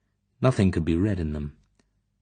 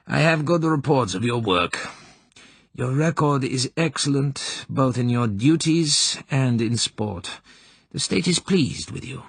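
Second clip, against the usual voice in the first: senior officer; 7 semitones higher than usual. A narrator's reading. Colonel praising the corporal, official and authoritative. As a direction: deliver praise like a superior. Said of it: "it was the voice of authority, of the senior officer".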